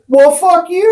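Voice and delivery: very high mock yell, half laughing; retold loud comeback. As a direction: yell it back in a high voice, playing it up. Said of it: "for, like, the second or third time loudly".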